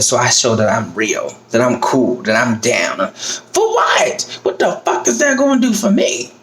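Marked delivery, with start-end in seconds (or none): 3.1-6.3 high, exasperated cry; outraged. Real, not acted. Challenging people who want her to act tough, outraged and high. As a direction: throw the question back in disbelief. Angry rant about acting fake.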